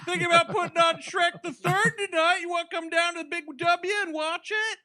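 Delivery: high, breathy Texan son voice; George W. Bush voice. Retold or imitated. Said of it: "Hey, Poppy".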